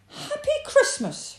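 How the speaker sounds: high, loud repeated greeting; cheery rabbit. A narrator's reading. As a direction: call out brightly as an eager rabbit. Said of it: "repeated Rabbit".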